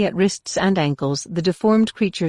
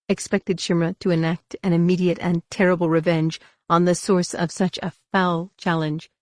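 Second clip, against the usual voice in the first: plain reading voice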